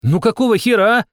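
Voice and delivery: high, loud outraged protest; indignant shout. A narrator's reading. Player protesting the cards she drew, shouting indignantly. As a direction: cry foul at a rising pitch. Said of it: "крикнула она".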